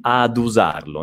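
"ad usarlo" with a plain a, no i sound. Shown as a demonstration. Teacher modelling "ad usarlo" for learners. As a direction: model "ad usarlo" clearly for the class. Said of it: "direttamente ad u, senza la i".

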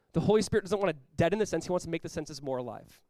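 usual speaking voice

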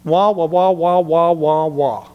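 muffled adult voice going wah wah; garbled wah wah like a cartoon grown-up; muffled wah wah talk. Shown as a demonstration. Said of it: "So I illustrate like this".

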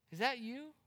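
normal talking voice

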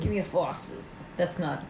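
put-on Boston accent, not Australian; failed Aussie, pure Boston. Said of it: "definitely a Boston accent".

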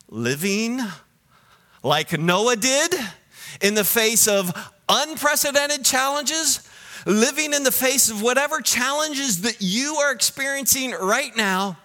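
rousing sermon delivery; forceful preaching. Real, not acted. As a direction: build with high, emphatic urgency.

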